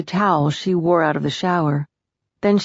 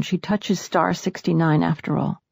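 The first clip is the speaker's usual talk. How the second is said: ordinary delivery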